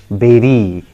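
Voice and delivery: "berry" as BEH-ree, falling; correct pronunciation. Shown as a demonstration. Teacher giving learners the correct form, "berry" as BEH-ree. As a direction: say "berry" with an eh vowel. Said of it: "Just repeat after me".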